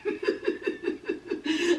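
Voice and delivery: long, voiceless run of chuckles; breathy giggling. Real, not acted. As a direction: crack up quietly at your own bit. Laughing after the acted denial.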